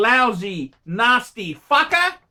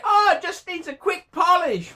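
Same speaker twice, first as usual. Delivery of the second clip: high, laughing mock voice; mock the naive seller with a laugh; mocking quote; 9 semitones higher than usual. YouTuber mimicking naive viewer, laughing mock falsetto. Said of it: "It's easy for you to say".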